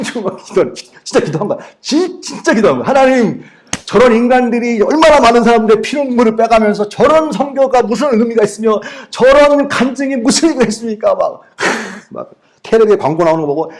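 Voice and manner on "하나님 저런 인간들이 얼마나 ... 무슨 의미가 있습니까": high, loud indignant prayer; furious prayer. Retold or imitated. Pastor reenacting his angry prayer against the dealer, high and loud. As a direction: rail at God, higher and louder.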